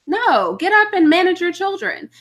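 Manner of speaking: exasperated mom calling out a mother at the playground; scolding another mother. Retold or imitated. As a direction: snap at her to manage her kids.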